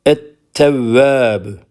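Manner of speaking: Et-tevvâbü with the l silent and tt doubled; sun letter demo. Shown as a demonstration. Teacher modelling the word for the class, slow and clear. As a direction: say Et-tevvâbü slowly, no lam. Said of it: "lamı okumuyoruz".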